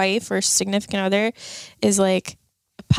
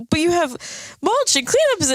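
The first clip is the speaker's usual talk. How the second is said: quoted excited pitch; 9 semitones higher than usual. Retold or imitated. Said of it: "and he's like".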